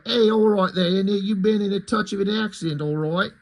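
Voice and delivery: lightly done fake British accent; mock British accent. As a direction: parody a Cockney British butler. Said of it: "trying to fake British dialect".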